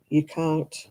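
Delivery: ordinary talk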